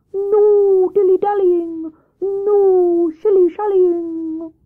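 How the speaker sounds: high, slow, loud hooting voice; commanding Wise Owl. Acted. Owl orders Hare and Squirrel, slow commanding hoots. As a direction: give orders in long-drawn hoots.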